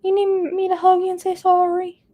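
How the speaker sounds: put-on teasing husband in baby voice; mock baby talk. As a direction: coo it like to a sulking toddler. Teasing husband after pizza fight.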